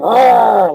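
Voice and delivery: loud, high doggy growl; snarling dog noise, drawn out; imitated dog growl. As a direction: growl like a dog wheeling round. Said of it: "like that".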